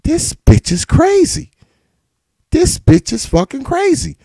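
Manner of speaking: high, slowed disbelieving voice; stunned falsetto muttering; exasperated inner voice. Retold or imitated. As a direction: say it sky-high in shocked disbelief. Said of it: "I'm like".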